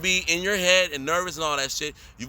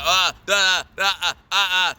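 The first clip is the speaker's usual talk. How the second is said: nervous stammer acted out, slightly louder; mock stuttering; 2 semitones higher, 5 dB louder than usual. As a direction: stumble over the words like a nervous actor. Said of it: "I'm stuttering".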